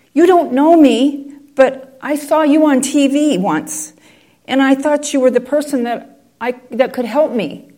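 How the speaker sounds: reach out timidly for help; desperate caller. Retold or imitated. Desperate caller explains herself.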